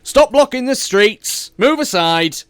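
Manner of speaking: loud barked order at the crowd; guard's shout. Acted. Armored guards shout at the crowd to clear the street.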